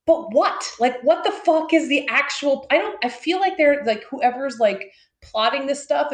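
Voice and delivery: fed-up disbelief, voice climbing; exasperated outburst. Real, not acted. Frustrated rant about planners.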